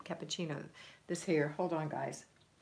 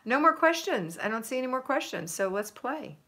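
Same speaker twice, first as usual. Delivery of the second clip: excited; 7 semitones higher, 8 dB louder than usual. Real, not acted. Excited exclamation to chat.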